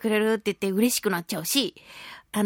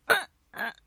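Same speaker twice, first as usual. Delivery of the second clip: imitated grunts of effort; mock struggling with a tight lid; short effortful grunts, acted out; straining grunts; 4 semitones higher, 5 dB quieter than usual. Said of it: "って頑張った結果".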